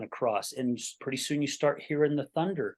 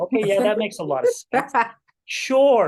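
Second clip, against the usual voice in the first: loud, high-pitched sarcastic agreement; mock agreement; 7 semitones higher, 8 dB louder than usual. Grown son mocking a parent's crying threat, sarcastic. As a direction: play up the absurdity with an exaggerated, raised "Sure".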